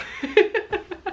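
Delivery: real laugh breaking out high; high burst of laughter. Real, not acted. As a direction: crack up at your own accent.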